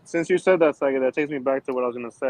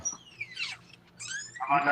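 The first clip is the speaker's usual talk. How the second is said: glitchy phone audio; 5 dB quieter, much slower than usual. Real, not acted. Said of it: "You're in a phone booth".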